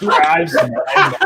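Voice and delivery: slow, loud mock shout. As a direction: bellow it back jokingly. Friend echoes phrase as a joke.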